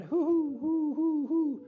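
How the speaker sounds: high, loud repeated owl hooting. Retold or imitated. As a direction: hoot like a barred owl. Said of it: "You sound like a great owl".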